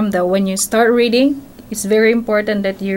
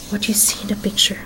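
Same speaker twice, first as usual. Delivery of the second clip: whisper; 5 dB quieter, faster than usual. Real, not acted. Asking the child mid-story.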